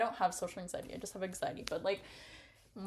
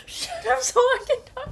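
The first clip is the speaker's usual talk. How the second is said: high, loud shout through tears of laughter; laughing yell; 8 dB louder than usual. Real, not acted. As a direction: yell while cracking up. Yelling at character, laughing.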